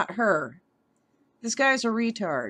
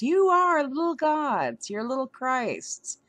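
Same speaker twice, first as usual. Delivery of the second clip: a hint of a preacher, higher then trailing off; mocking preacher; 4 semitones higher than usual. Mocking what prosperity preachers tell believers, mild preacher parody. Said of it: "prosperity gospelers are trying to tell us".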